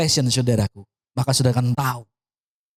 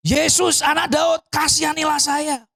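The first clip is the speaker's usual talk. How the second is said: high, sustained imitation of shouting; retold louder shout; 10 semitones higher, slower than usual. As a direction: cry out insistently for mercy in one push. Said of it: "teriak lebih kenceng lagi". (menirukan teriakan lebih kencang)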